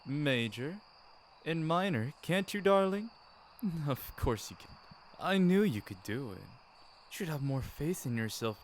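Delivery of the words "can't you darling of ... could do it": ordinary delivery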